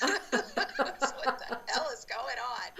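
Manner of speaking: high-pitched, laughing disbelief. Real, not acted. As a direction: talk through helpless laughter. Laughs while retelling phone scare.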